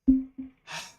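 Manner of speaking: loud high sigh of frustration; exasperated sigh. Real, not acted. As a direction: heave a fed-up sigh. Frustration during the game.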